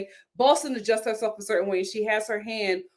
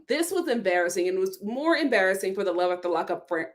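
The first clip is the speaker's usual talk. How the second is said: normal talking voice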